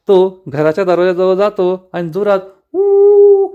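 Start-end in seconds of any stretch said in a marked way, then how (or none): 2.7-3.5 high long howling cry; loud howl. A narrator's reading. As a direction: howl like a wild animal to scare her. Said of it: "असं ओरडतो". (जनावरासारखी मोठी आरोळी)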